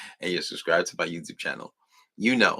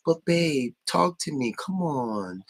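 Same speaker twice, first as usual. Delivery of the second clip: high, whiny pleading; high-pitched pandering whine; mocking needy boyfriend; 7 semitones higher, slower than usual. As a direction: beg like a clingy boyfriend. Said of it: "he's trying to pander to her".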